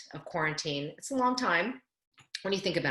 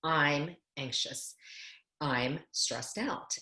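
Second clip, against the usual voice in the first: slow, soft, honest self-talk; name your feeling calmly to yourself; calm admission; 4 semitones lower, 5 dB quieter, much slower than usual. Shown as a demonstration. Coach showing clients how to admit stress, slow and calm. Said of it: "actually say".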